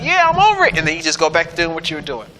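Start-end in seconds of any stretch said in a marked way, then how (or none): 0.0-0.8 a high, bright burst of fake triumph; higher, cheerful brush-off; mock excitement. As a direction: toss it off like the trial is already beaten. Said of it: "we get real excited".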